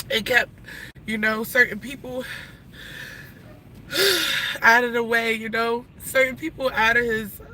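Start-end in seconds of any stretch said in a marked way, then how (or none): 3.9-4.6 short sigh breaking into a sob; crying sigh. Real, not acted. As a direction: let the breath catch into a small cry. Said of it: "it becomes very emotional".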